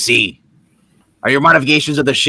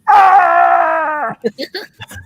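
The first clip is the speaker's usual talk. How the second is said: big shrieking burst of laughter. Real, not acted. Loud laugh at joke.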